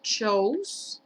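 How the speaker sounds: CHOSE drawn out as "chouz", rising; "o-u" diphthong. Shown as a demonstration. Teacher modelling the vowel for students, long CHOSE. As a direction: let the O in CHOSE slide into U. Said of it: "como si tuviera una O y una U".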